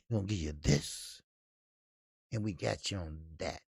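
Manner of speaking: low, hushed sales pitch; smooth dealmaker. Retold or imitated. Triller quietly pitching Saint Pierre under the table, low and smooth. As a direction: sweet-talk him into the deal quietly. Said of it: "Triller thought they can pretty much say".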